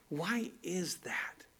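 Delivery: breathy whispered question, slow. Real, not acted. Preacher asks rhetorical question.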